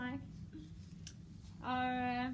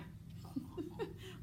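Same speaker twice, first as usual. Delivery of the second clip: mocking laugh; 6 semitones higher, 13 dB quieter than usual. Shown as a demonstration. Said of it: "laughing like".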